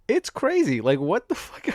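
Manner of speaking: normal talking voice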